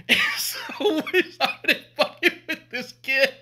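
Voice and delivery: high, slow words through real laughter; cracking up while telling the prank; laughing. Real, not acted. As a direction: struggle to talk through the laughs.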